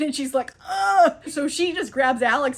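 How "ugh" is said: high, loud mock gasp; startled "ugh". Cleopatra spotted by Ramses, startled exclamation. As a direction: let out an acted startled noise.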